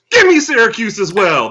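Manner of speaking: loud mock Joe making his pick; Joe impression. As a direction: ham up your co-host's big pick. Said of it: "That was my Joe impression".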